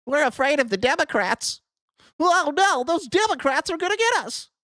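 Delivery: high, hammed-up panic; mock fearful voter. Mocks fearful voters in their voice.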